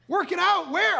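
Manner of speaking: high-pitched disbelieving question; incredulous echo. Retold or imitated. Husband questions wife after the missile scare, incredulous. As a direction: throw the words back, acted disbelief.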